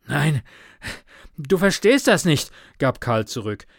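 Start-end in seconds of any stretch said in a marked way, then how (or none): 0.1-2.5 higher, louder breathless objection; agitated and short of breath; winded protest. A narrator's reading. Winded runner protests to friend.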